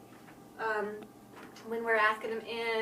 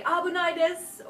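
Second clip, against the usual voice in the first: warning call; 7 semitones higher, 8 dB louder than usual. Retold or imitated. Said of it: "you may hear someone say".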